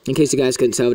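ordinary delivery

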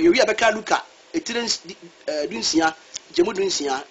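ordinary talk

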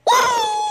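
high startled shout of uh; excited yelp. Real, not acted. Gamer reacting to a near miss, high startled shout.

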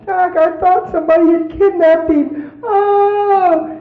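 high, loud mock wail; imitated crying. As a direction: play the frantic wife, hammed up. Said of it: "she was crying her eyes out".

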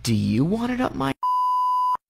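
retold comeback. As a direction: turn the threat back as a slow question, without shouting. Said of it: "And I go".

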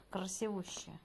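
normal talking voice

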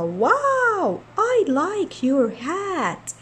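high, very slow Sfroota voice; cartoon girl admiring. Sfroota praising the girl's hat, high and very slow. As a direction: gush over the hat like a cartoon kid.

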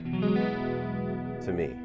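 usual speaking voice

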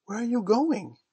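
high, anxious cry; misgiving. A narrator's reading. Wendy sees Peter heading for the window, cries with misgiving. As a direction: call out in sudden misgiving, pitched high. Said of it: "she cried with misgiving".

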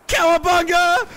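loud high battle cry; excited yell. Real, not acted. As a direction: yell it as you launch. Launching sled down mountain.

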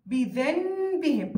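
بذنبهم drawn out, nun turned into a held, hummed m; iqlab with ghunna. Shown as a demonstration. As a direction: hold a nasal m before the ب in بذنبهم. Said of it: "بذنبهم مع وجود غنة".

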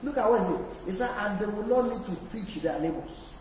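loud, high, soaring sermon climax; full-force preaching. Acted. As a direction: shout God's agenda to the whole church.

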